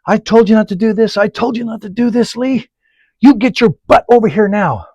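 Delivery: high, loud furious yell at a stuck driver; angry yelling. Retold or imitated. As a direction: bellow like a boss losing his temper. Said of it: "that's what he's yelling".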